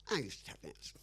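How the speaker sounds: put-on breathy whispered old-timer line; whispered old man's voice; whispery old man. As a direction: hush it like an old dancer remembering. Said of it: "you talk like".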